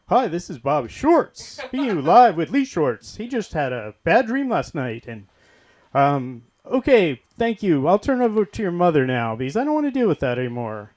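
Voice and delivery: loud, booming radio announcer voice; broadcaster dad impression. Father announcing his son's bad dream, loud broadcaster voice. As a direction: ham up a live broadcast report. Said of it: "a wannabe broadcaster of a father".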